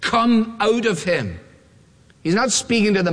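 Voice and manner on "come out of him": slow, higher-pitched command; stern. Acted. Jesus ordering the demon out of a man, sternly. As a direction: order it out firmly, word by word. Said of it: "said Jesus sternly".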